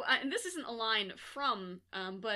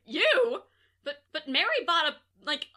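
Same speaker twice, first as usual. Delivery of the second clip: flustered Jesus; 7 semitones higher, 12 dB louder than usual. Retold or imitated. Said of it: "and Jesus is like".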